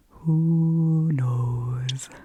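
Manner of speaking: drawn-out owl hoot on "Who"; Thane the owl. Retold or imitated. Owl answers departing Fernley, long hooting voice. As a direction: stretch both words long and loud like an owl. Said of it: "And he says".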